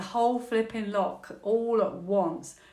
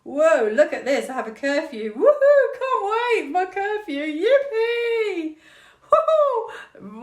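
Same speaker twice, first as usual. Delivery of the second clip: sarcastic cheering, much higher and louder; mock celebration; 10 semitones higher, 5 dB louder, slower than usual. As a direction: celebrate a curfew with ironic glee. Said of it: "I don't know why I'm celebrating".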